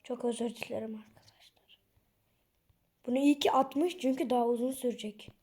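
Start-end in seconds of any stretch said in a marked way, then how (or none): 0.0-1.8 soft whisper of sorry; whispered apology. Real, not acted. Apologising to viewers. (fısıltıyla özür)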